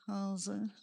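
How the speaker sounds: mumbled, soft greeting with no energy; flat, low-energy greeting. Shown as a demonstration. Newcomer greeting friends, flat and soft. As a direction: greet the group like you can't be bothered. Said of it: "comes into your group ... and says".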